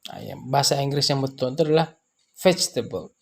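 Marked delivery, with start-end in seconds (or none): 2.4-3.2 vegetable dengan VEG ditekan lalu turun; tekanan di VEG. Shown as a demonstration. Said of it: "Kita tekan di sana ada vegetable". (vegetable, tekanan pada suku pertama)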